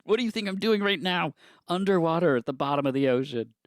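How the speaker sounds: high, loud mock diver yelling; shouting underwater. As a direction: yell up from the ocean floor.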